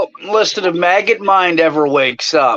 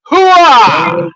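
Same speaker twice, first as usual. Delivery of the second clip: shouted army battle cry; loud gung-ho soldier; 6 semitones higher, 9 dB louder than usual. Acted. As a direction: yell it like a pumped-up soldier. Referee promo ends with war cry.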